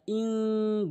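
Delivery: "ইন" held as a long nasal hum; ghunna. Shown as a demonstration. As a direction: sustain the n of "ইন" through the nose. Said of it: "গুন্না করতে হবে". (নাকে টেনে গুন্নাসহ "ইন")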